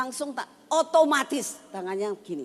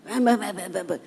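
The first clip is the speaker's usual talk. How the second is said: mock gibberish prayer; 6 dB louder, faster than usual. Grandma imitates grandchild pretending to bless the bread, mock gibberish. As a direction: imitate a toddler's pretend praying in babble. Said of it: "Saya nggak tahu dia ngomong apa".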